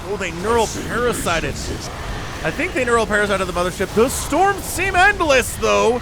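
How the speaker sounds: high, loud battle commentary; excited play-by-play. Real, not acted. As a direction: call the big fight at full excitement. Commentating a big battle live.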